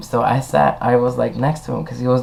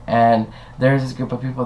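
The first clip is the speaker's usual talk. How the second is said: usual speaking voice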